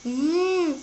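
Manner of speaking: appreciative hmm after a sniff; pleased sniff hum. Acted. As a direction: savour a sweet smell. Smells perfume, says wangi.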